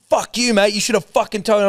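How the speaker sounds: high, heated imitation of an irate caller; mock yell, sweary and indignant; retold angry outburst. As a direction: act out the furious telling-off. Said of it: "he screamed at me once down the phone".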